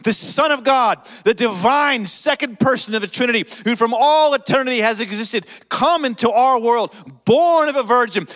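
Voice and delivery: loud, rising pulpit proclamation; full-force preaching. Acted. Pastor proclaiming the creed to the congregation, loud preaching.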